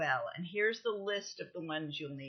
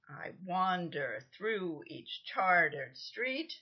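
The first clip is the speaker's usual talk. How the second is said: slow line with the beat on "wander", "each chartered street" stressed on CHAR and STREET; iambic stress; slower than usual. Shown as a demonstration. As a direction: read "I wander through each chartered street" slowly, unstressed then stressed. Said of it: "an unstressed syllable followed by a stressed syllable".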